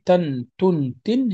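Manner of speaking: tan, tun, tin as separate syllables; tanween syllables isolated. Shown as a demonstration. Teacher names tanween to students, tan-tun-tin sounded out singly. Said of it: "هذا الذي نسميه تنوين".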